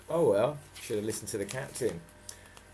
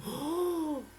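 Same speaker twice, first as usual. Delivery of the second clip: gasp. Acted. Reader gasps at the bite.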